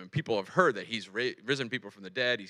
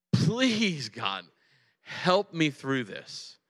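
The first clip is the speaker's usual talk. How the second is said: pleading prayer; 2 semitones higher, much slower than usual. Acted. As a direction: plead to God slowly, dropping after the first word. Voices Jesus praying in anguish.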